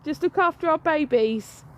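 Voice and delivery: warning him from afar; calling out. Real, not acted. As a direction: call out to your husband in the distance. Still calling to husband.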